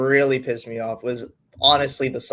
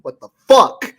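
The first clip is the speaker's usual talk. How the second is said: disbelief; 8 dB louder than usual. Retold or imitated. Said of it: "and I'm just like".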